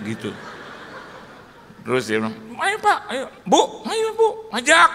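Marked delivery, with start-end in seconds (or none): 2.6-4.9 much higher, louder urging call; eager urging. Retold or imitated. Friend eagerly urges pastor and wife to come, high and loud. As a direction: push them to come along.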